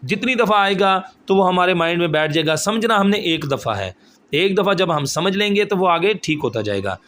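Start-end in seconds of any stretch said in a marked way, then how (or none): none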